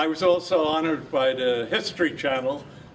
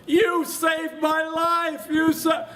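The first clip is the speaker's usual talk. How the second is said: much higher, very slow mock shout; retold shout; 13 semitones higher, 4 dB louder, much slower than usual. Veteran imitating the grateful Polish man's shout, high and slow. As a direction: cry it out like an overjoyed man, much higher. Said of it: "he shouts at the top of his lungs".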